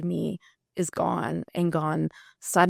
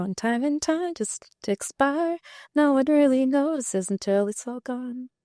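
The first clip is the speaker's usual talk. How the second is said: lyric quoted; 8 semitones higher than usual. Retold or imitated. Quoting her own lyric.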